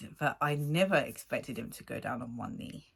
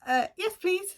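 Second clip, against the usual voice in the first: imitating fiancé; 3 dB louder than usual. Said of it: "And he's like".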